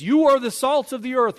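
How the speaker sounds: "You are" lifted high and loud; ringing declaration; proclaimed. Retold or imitated. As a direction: announce it like Jesus's verdict. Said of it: "and Jesus says".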